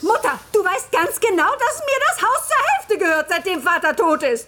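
high, loud and furious; angry outburst. Acted. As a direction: rise up and confront her mother. Daughter's quarrel with mother.

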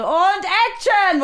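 high, slow, stretched-out "Und Action!"; a bellowed, drawn-out take cue; mock director shout. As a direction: ham up a pompous director's call. Mocking directors calling action.

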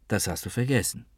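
softly, as if to herself; quiet, serious. A narrator's reading. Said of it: "Sie sagte es ruhig und ernst". (leise und ernst, wie zu sich selbst)

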